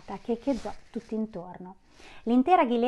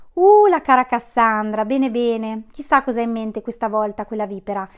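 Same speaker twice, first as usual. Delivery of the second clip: loud, lordly count sneering at the witch; a count scorning the witch to her servant, loud and haughty; authoritative thunder; 10 dB louder than usual. Acted. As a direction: boom it out, mocking the dear Cassandra. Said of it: "tuonò autoritario il conte".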